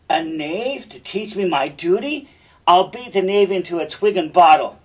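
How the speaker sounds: outraged stage bluster; angry threat. Acted. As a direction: threaten a knave, building in volume. Cassio raging in a fight.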